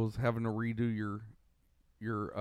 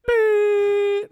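long, high, loud imitation beep; piercing mock voicemail beep; imitated beep; 10 dB louder than usual. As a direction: mimic an answering-machine tone, held loud and high. Fakes a voicemail tone as joke.

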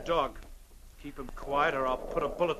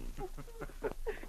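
mocking laugh; 9 dB quieter than usual. Villain laughs at Mountie.

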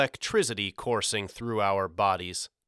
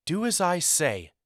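high-pitched, curt motherly order; stern snap; snapped command; 7 semitones higher than usual. A narrator's reading. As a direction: cut off the protest sharply. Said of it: "she snapped".

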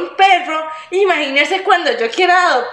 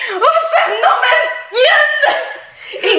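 high, slow, laughing mock wail; mock whiny sob; 11 semitones higher, much slower than usual. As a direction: wail it like a spoiled child through laughter. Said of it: "¿Y entonces por qué te preocupas".

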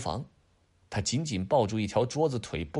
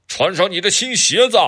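loud, higher calling out; 9 semitones higher, 10 dB louder than usual. Acted. Dad calling down the drain to Adam, loud call.

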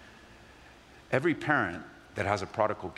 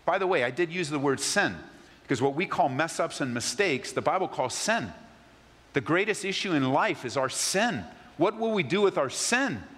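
usual speaking voice

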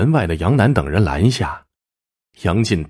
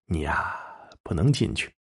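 soft, teasing refusal; amused refusal; 3 semitones lower, 9 dB quieter than usual. A narrator's reading. Henchman blocks the husband at the door, soft amused refusal. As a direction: hold him back with mock politeness. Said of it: "笑着说".

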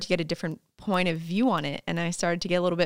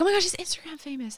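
quick, high mock gush, a touch softer; breathless fan-girl gush, imitated lightly; mock gushing friend; 8 semitones higher, 5 dB quieter, faster than usual. Friend gushing about her being Instagram famous, quick mock gush. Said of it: "some of my friends would be like".

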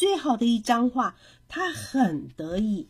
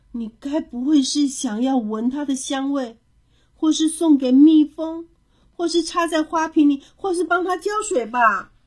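climbing higher and louder through a list; worried questioning; 5 semitones higher, 7 dB louder than usual. A narrator's reading. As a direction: fire off anxious suspicions, getting more insistent. Child fears father misunderstands too. (着急地高声追问)

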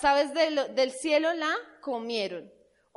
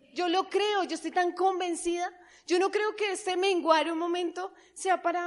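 ordinary talk

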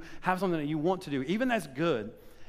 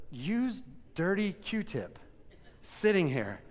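slow, drawn-out disgust; dirty Q-tip said slowly with disgust; 3 semitones higher, much slower than usual. Retold or imitated. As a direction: draw out "dirty" in revulsion. Disgusted find on the pulpit.

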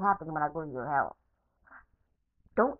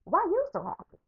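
high, louder mock daughter voice; daughter's voice; 13 semitones higher, 4 dB louder than usual. His daughter on the phone, in a high mock voice. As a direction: play the daughter asking, pitched way up. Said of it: "and she was like".

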